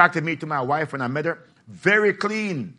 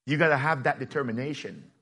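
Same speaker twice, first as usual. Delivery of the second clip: normal talking voice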